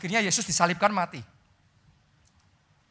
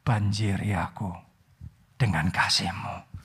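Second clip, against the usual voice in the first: lower, hushed prayer spoken as an example; model prayer; 10 semitones lower than usual. Shown as a demonstration. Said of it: "ngomong dalam hati saudara kepada Tuhan". (doa yang pelan dan rendah hati)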